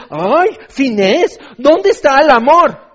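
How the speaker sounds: high, loud, drawn-out lament; hammed-up indignant plea; mock reproach. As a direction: wail a complaint in exaggerated dismay. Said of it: "Y dices".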